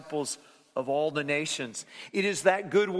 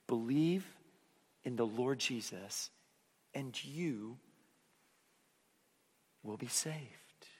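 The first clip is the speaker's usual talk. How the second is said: soft, slow and hushed, fading at the end; gently to children; 4 semitones lower, 6 dB quieter, slower than usual. Shown as a demonstration. Preacher to the kids in the room, soft and gentle. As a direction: tell it softly to a child.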